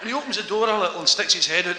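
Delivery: ordinary delivery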